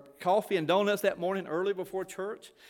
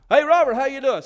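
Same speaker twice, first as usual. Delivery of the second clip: much louder, higher imitation of a hearty hello; loud hailing greeting; 5 semitones higher, 10 dB louder than usual. Townsperson insists on the wrong name, loud hailing greeting. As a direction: call out as if hailing someone across the street.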